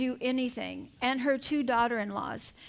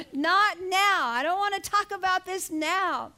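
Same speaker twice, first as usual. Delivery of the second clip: higher-pitched retold refusal, loud at the start; acted protest; 4 semitones higher, 4 dB louder than usual. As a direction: re-enact a stubborn plea to be left alone. Repeats her acted protest after laughter.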